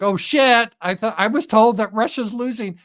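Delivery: high, startled parody of Trump; mock Trump. Trump realizing Russia isn't losing, mock startled voice. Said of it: "then Trump is like".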